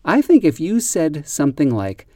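normal talking voice

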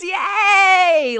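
high, drawn-out hooray; gleeful high-pitched cheer; excited cheer. Real, not acted. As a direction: cheer it out, stretching the word. Cheering the prospect of hearings.

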